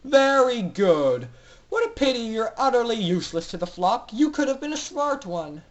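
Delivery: mock the prisoners with cruel pleasure; sneering villain doctor. Doctor Atmosphere sneering at the captive foals, loud and cruel.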